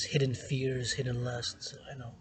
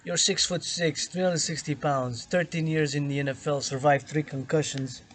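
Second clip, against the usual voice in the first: exasperated; 3 semitones higher, 6 dB louder than usual. Retold or imitated. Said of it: "I said".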